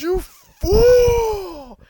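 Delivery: very high, loud furious shout; screamed retort. Retold or imitated. Goku screams at Frieza after the betrayal, a furious shout. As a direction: scream it at the villain.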